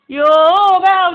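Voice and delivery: loud, slow, high "يوه بقى"; exasperated whine. Acted. Girl fed up with the money talk, loud slow whine. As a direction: drag out the annoyance.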